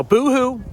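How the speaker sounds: high mock crying boo-hoo. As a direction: tease with a fake sob. Mocking complaints about cold.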